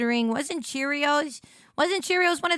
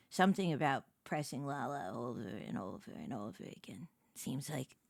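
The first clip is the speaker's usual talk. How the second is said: lower, softer whispered aside; unvoiced whisper; 11 semitones lower, 13 dB quieter than usual. Real, not acted. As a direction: breathe it as a secret aside.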